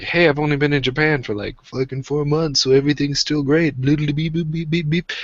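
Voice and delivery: high, fast upbeat newcomer voice; bubbly, naive and chirpy; cheerful foreigner impression. Imitating a cheery newcomer to Japan, upbeat and high. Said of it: "a friendly man who was still like".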